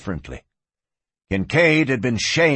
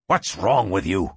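snapping; 5 semitones lower than usual. Acted. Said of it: "Kincaid snapped".

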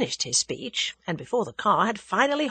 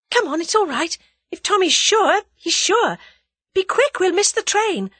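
much higher and louder, breathless urgency; urgent hurry; 12 semitones higher, 7 dB louder than usual. A narrator's reading. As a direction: rush her along. Hurrying Jane to the train.

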